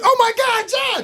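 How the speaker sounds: very high mock scream; shocked shriek. Family seeing naked Josh walk in, imitated shriek. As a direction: shriek it in scandalized horror, half laughing. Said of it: "All you hear is".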